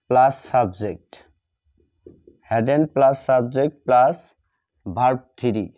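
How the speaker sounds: stretched, very slow formula read word by word; slow dictation. Shown as a demonstration. Teacher writing the formula on the board, dictating very slowly. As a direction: spell out the formula slowly so students can copy.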